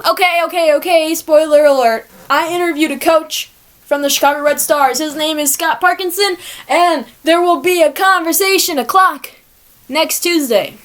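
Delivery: high, loud, gleeful announcement; excited reveal. Real, not acted. Revealing next week's guest to listeners, excited announcement.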